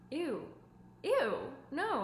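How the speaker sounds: high, loud grossed-out "ew, ew, no"; disgusted ew. Pretend. To a partner who shows red flags, a loud disgusted ew. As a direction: recoil in disgust like something smells bad. Recoiling from someone's bad treatment.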